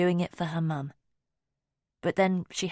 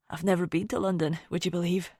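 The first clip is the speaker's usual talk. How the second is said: lightly voiced Scottish man. A narrator's reading. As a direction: humour him in a light Scottish accent. Finn's voice, wistful.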